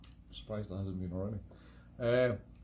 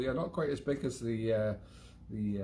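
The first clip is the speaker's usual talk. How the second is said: ordinary delivery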